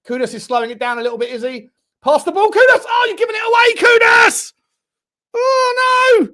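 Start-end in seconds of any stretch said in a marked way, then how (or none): 2.0-6.3 high, loud yelling at a player; exasperated shouting. Real, not acted. Fan commentator yelling at Kudus for losing the ball, exasperated shout. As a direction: yell it in dismay as possession is lost.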